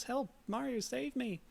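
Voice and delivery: high, lightly voiced cry for help; damsel calling for help. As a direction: call out like a captive princess.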